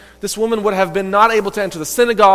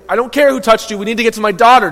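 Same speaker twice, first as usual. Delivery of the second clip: urgent plea; 6 semitones higher, 7 dB louder, faster than usual. Acted. Desperate father urging Jesus on.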